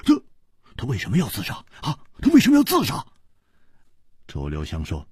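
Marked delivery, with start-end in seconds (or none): 0.0-3.0 high, fast eager interruption; cutting in eagerly. A narrator's reading. Hu Tiehua cutting Chu off, high and fast. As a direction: rush in before he finishes. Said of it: "就抢着说".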